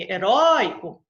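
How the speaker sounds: heróico with a loud ró; heróico with a strong ró and a falling end; stress on the second-to-last syllable. Shown as a demonstration. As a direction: lean on ró in heróico. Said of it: "a, é a penúltima".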